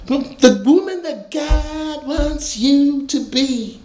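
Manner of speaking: high, slow imitated Nation of Islam recruiter accent; put-on accent. Recruiter coaxing a young man, in an imitated accent. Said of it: "you're gonna have to forgive the accent".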